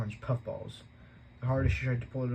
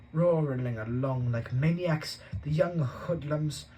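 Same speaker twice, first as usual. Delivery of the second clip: slow, louder indignant grumble; grumbling complaint; 4 semitones higher, 5 dB louder, much slower than usual. Acted. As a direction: scorn the bikers. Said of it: "Uncle Vernon complained".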